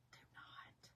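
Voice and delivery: very soft, hushed prohibition; whispered warning. Real, not acted. Vlogger warning viewers off perfect pumpkins, whispered. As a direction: drop to a whisper to warn them. Mock warning, repeated low.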